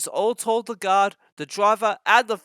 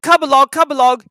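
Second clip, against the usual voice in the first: much higher, louder chug; puffing; 9 semitones higher, 9 dB louder than usual. Acted. James urging the trucks on, higher and louder. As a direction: puff it out like a steam engine. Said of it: "he puffed".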